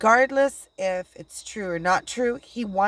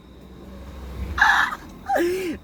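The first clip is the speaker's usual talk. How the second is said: teary laugh; 14 semitones higher than usual. Real, not acted. Laughing at absurd situation.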